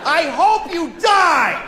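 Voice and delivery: hammed-up road rage curse; mock road rage at another driver; mock yell. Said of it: "I said that to a person".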